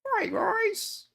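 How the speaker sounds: squeaky high cartoon duck voice; Donald Duck voice. Streamer trying a Donald Duck greeting, squeaky duck voice. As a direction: go way up into a duck squawk. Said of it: "What does Donald Duck sound like?".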